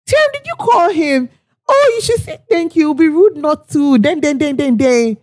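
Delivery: high-pitched mock nagging; nagging client. Client phoning to push planner to thank him, nagging. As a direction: imitate a client scolding, pitch easing into babble.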